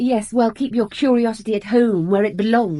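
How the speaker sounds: sharp rebuff. Acted. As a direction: snap back to shut the question down.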